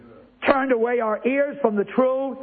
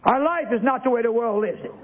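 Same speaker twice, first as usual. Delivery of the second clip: ordinary talk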